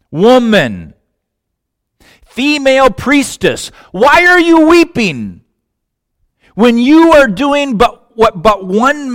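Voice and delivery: builds from a call to a high, loud cry; rising plea. Acted. Jesus crying out to weeping Mary, high loud plea.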